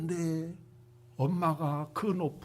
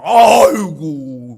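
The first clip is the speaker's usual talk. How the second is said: mock wail; 2 semitones lower, 15 dB louder, much slower than usual. Mocking people who grumble.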